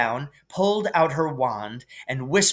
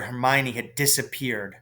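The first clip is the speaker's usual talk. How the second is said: usual speaking voice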